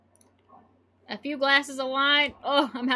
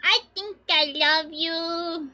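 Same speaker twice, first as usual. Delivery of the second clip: slow, whiny, mock-babyish drunk voice; imitating a clingy drunk baby; drunk toddler whine; 4 semitones higher, much slower than usual. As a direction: whimper it like a sleepy drunk toddler. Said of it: "I'm just like".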